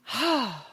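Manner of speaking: big heavy sigh; dramatic sigh. Real, not acted. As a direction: let out a long weary sigh. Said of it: "That's a big sigh".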